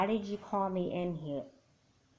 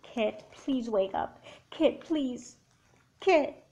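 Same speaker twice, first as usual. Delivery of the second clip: slow pleading rising to a high cry; desperate pleading; 5 semitones higher, 3 dB louder, slower than usual. Acted. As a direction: beg her to wake up, breaking. Sister begging dead sister.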